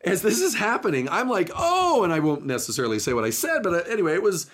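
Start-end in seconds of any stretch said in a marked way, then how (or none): 1.6-2.0 startled gasp. Retold or imitated. Near crash at red light.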